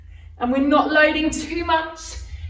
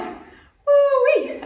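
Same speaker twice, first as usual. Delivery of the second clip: excited whoop; 12 semitones higher than usual. Real, not acted. Instructor cheering after exercise set.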